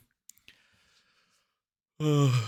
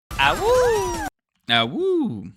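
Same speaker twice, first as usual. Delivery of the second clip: howling like a wolf pup; much slower than usual. Acted. Streamer howls like wolf pup.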